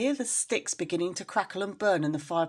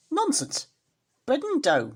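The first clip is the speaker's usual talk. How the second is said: much higher, louder indignant retort; scoffing rat wife; 9 semitones higher, 5 dB louder than usual. Acted. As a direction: brush him off sharply. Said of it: "replied Anna Maria".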